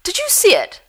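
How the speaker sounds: excited mother. A narrator's reading. Mum spotting a paradise flycatcher, excited high voice. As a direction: gasp with delight at the bird. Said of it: "with a little gasp of excitement".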